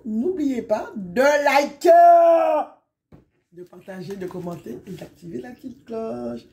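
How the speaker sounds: a long drawn-out yelled call that drops to a hushed, strange little witch croon. Acted. Vlogger signing off to viewers, in her little witch voice. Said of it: "avec ma petite voix de sorcière".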